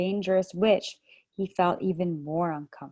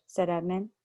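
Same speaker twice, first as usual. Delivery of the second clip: usual speaking voice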